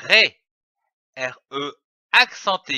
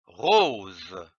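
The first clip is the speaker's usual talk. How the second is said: lecture grave; 9 semitones lower, 4 dB quieter than usual. Shown as a demonstration. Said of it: "Je lis une phrase".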